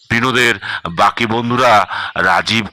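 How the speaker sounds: ordinary delivery, nothing marked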